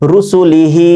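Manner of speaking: রুসুলিহি with short রুসুলি, long held হি; final-vowel elongation. Shown as a demonstration. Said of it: "হা খরচের হি এখানে এক আলিফ পরিমাণ টান". (রুসুলিহি-র শেষে হি টেনে পড়া)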